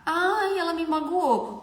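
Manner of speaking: high-pitched mock-wounded complaint; mock hurt. Makeup artist taking a client's reaction personally, mock hurt. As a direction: play up the hurt, pitched right up.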